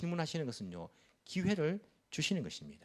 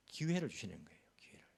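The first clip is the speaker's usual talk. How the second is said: whisper; 2 semitones lower, 7 dB quieter than usual. Real, not acted. Pastor stresses God's chance.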